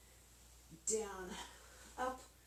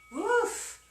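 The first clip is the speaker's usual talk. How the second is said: exhausted woof; 13 semitones higher, 5 dB louder than usual. Real, not acted. Exhale after hard set.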